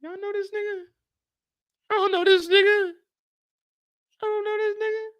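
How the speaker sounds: high mock bewilderment of the gang; baffled crowd. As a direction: voice the puzzled crowd high and incredulous.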